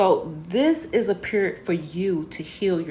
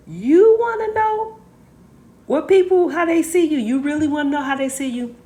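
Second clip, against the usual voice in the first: playful high-pitched build-up; teasing question; 9 semitones higher, 5 dB louder than usual. Pretend. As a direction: hype up the question in a high, loud voice. Hyped question teasing the viewers.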